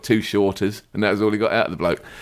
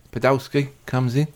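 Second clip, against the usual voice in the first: ordinary talk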